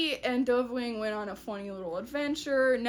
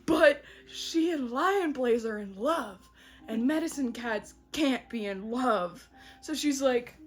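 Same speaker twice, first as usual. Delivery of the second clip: ordinary delivery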